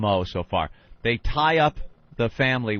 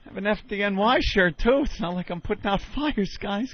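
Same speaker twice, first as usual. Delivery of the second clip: higher, whiny pleading victim voice; a put-on pleading homeowner, pitched up; 7 semitones higher than usual. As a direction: beg the robbers not to hurt you.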